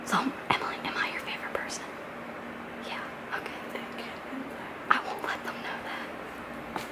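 secretive whisper. Real, not acted. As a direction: whisper it so family won't hear. Asking secrets with parents near.

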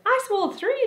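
high, loud centipede boast; competitive cry. A narrator's reading. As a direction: cry out to outdo the others. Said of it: "the centipede cried".

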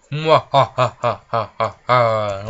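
slow, loud evil cackle; evil laugh. Pretend. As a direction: cackle like a gloating villain. Villain laugh after spell.

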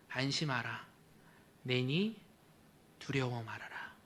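soft, tender comforting words; tender reassurance. Acted. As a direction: gently soothe frightened disciples. Jesus' words voiced softly. (예수님이 제자들에게 부드럽게 안심시키며)

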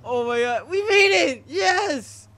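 high, loud cry of relief; relieved laughter. Real, not acted. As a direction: shout it out, finally safe. Reaching other side safely.

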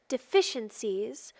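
usual speaking voice

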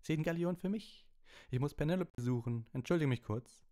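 high, cheerful excited chatter; Percy, delighted. A narrator's reading. Percy excusing himself to find Penelope, cheerfully excited. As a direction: keep it high and pleased. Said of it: "sagte Percy vergnügt".

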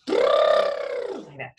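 unvoiced lip trill on the words; buzzing lip trill demo. Shown as a demonstration. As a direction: flap the lips in a brr. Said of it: "trill your lips".

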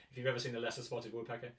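ordinary delivery

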